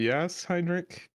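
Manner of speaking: slow, high mother answering. Acted. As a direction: answer gently through the door. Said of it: "She just says".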